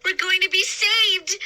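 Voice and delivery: high, loud joyful cry. A narrator's reading. Girl on the raft seeing the ship come, joyful cry. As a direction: burst out with relief. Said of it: "I cried".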